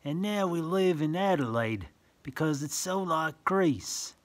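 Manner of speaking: sing-song rhyming read-aloud; reading verse to children. Acted. Reading a picture book to a child, sing-song. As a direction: lilt the rhyme for little listeners.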